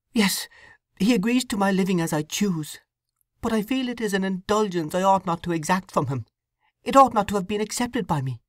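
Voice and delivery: put-on a troubled young wife confessing; young woman Sue. Sue laments.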